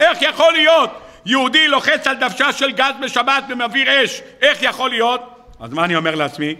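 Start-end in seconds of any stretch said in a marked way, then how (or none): 0.0-5.2 high-pitched, incredulous exclamation; mock outraged protest. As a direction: protest it as if scandalized, pitched well up. Acted shock at Shabbat driving.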